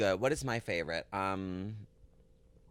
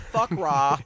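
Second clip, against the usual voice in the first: louder emphatic curse; 4 semitones higher, 6 dB louder than usual. Pretend. Repeats the title louder.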